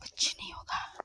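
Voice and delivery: whispered self-reassurance. Real, not acted. Sitting on the slope, whispering to calm herself. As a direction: breathe the words out to stay calm. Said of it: "मैं बैठ गई हूँ".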